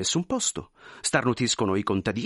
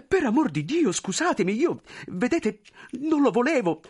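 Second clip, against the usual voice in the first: much higher, pleading, flustered voice; anxious clerk; 13 semitones higher than usual. Acted. Clerk pleading with the general, high and flustered. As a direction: beg forgiveness nervously.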